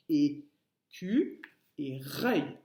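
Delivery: "écu et reuil" slowly broken into separate syllables. Shown as a demonstration. Teacher showing pupils the parts of squirrel, "écu et reuil" split syllable by syllable.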